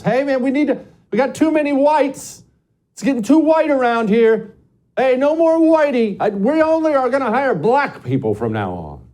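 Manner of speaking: high, loud mocking shout; hammed-up high-pitched indignant announcement; mock airline spokesman. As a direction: holler it like a caricature, high and loud. Voicing airline articles' hiring demands.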